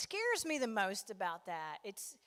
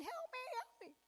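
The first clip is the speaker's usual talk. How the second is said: much higher, thin mock cry for help; tiny box guy; 6 dB quieter than usual. Little box guy pleads for rescue, high tiny voice. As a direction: squeak like a trapped little box man. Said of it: "he's screaming".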